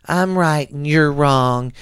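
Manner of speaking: slow, smug mock voice; mocking know-it-all. Mocking divided people, slow and smug. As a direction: drag it out like a stubborn know-it-all. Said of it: "wanna stay divided and be like".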